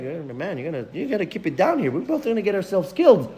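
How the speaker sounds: nervous near-whisper; hushed warning. Retold or imitated. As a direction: urge him to keep it down. Hushing the loud stranger nervously.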